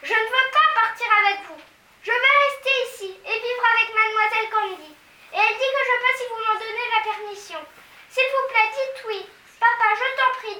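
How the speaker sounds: put-on high, loud begging child; high loud child's plea; pleading girl. A narrator's reading. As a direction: plead with mum and dad. Mathilda pleading with parents.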